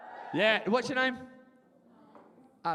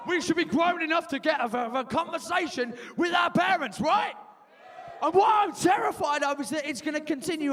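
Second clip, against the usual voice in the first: high, forceful comic tirade; impassioned rant; 7 semitones higher, 3 dB louder than usual. Real, not acted. Terrified of never outgrowing rows with mum, impassioned rant. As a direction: appeal to the crowd at shouting pitch.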